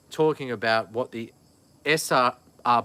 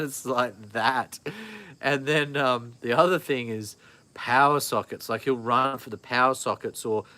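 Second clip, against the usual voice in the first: ordinary talk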